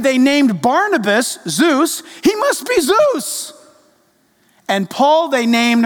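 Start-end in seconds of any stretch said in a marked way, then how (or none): 2.2-3.5 high, drawn-out mock awe; awed exclamation. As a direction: exclaim it slowly and high like a dazzled crowd. Voices the awed crowd naming a god.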